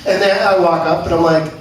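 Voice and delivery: usual speaking voice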